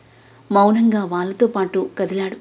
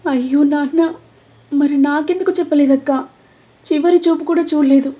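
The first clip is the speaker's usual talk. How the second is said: much higher, wailing sobbed words; crying out to his sister for a last look; bursting into tears; 7 semitones higher, 3 dB louder than usual. A narrator's reading. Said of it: "అక్కను పట్టుకొని బోరుమన్నాడు కన్నా". (బోరుమని ఏడుస్తూ)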